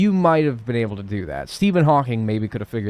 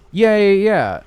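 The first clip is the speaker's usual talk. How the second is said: excited; 9 semitones higher, 9 dB louder, slower than usual. Real, not acted. Recognizes a fish he met before.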